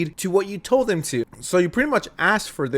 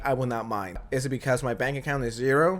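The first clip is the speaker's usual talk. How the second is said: ordinary talk, nothing marked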